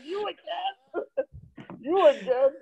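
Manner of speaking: high, laughing imitation of a dismayed crowd; mock disbelief. Crowd to the returning comic, high mocking disbelief.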